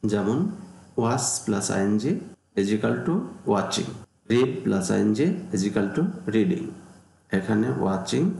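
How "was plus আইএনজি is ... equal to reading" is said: slow and deliberate; measured, step-by-step rule reading; slow formula reading. Shown as a demonstration. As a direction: lay out each piece for the class. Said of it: "teacher reads formula aloud".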